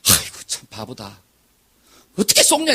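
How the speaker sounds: mocking scorn. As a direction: sneer at the fool who got tricked. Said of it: "많은 사람들은 그래".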